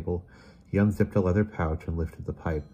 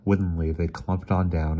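usual speaking voice

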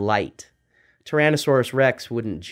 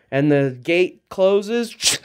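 ordinary delivery

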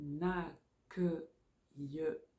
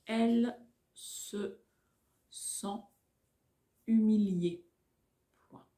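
dictating; 3 semitones higher, 5 dB louder than usual. Shown as a demonstration. Dictation slowed.

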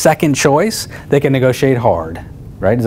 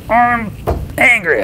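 retold anger; 11 semitones higher, slower than usual. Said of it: "He's like".

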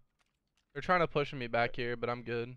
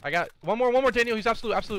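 high, loud shouted callout; excited shout; 8 semitones higher, 7 dB louder than usual. Real, not acted. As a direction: yell it out over the fight. Excited kill callout mid-fight.

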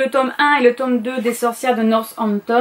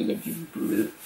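a breathy French whisper, much softer; whispered aside; 7 semitones lower, 12 dB quieter, much faster than usual. Real, not acted. Pointing out the blue cover to viewers, whispered. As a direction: let it drop into a soft whisper.

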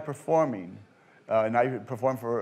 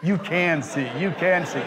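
mock self-correction, firmer and a little higher; arguing with herself; 4 semitones higher than usual. Actress watching card tricks, insistently arguing with herself. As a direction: imitate reversing your own verdict, insistently. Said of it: "she's sitting there arguing with herself".

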